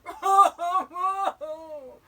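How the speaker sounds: high, wailing boo-hoo sobs; loud mock sobbing. A narrator's reading. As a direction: sob hugely like a storybook bear. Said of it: "the sound of sobbing".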